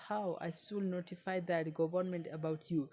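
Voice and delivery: normal talking voice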